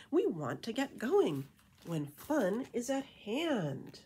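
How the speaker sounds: lilting rhymed storybook read; reading to children. A narrator's reading. Reading a bat beach story to kids, lilting rhyme. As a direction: read it like a bedtime picture book. Reading rhyming picture book aloud.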